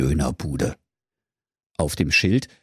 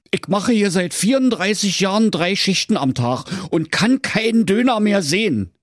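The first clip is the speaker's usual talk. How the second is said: fed-up kebab seller; 11 semitones higher than usual. Acted. Kebab seller asks Schnitzelschorsch a favour, high weary voice. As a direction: sigh out his endless shifts.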